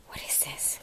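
hushed question; whisper. Real, not acted. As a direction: whisper it like a secret.

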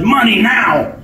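demanding outlaw yell; shouted demand. A narrator's reading. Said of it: "when the robber shouted".

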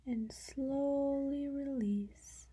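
very slow, with "slowly" stretched long; drawn-out calming. Real, not acted. Guide leading the listener to release a held breath, drawn out. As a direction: stretch it to the length of an exhale.

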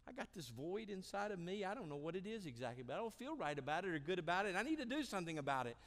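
high, fast, rising plea; pressing, hurried confession to God; urgent confession. Acted.

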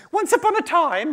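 very high-pitched little creature; small animal voice. Acted. Muskrat stalling a hungry tiger, high squeaky voice. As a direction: plead in a tiny high voice. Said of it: "all my small animals have got the same voice".

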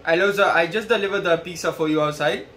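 ordinary delivery

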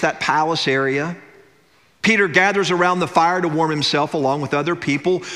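ordinary talk

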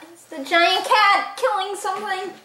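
usual speaking voice

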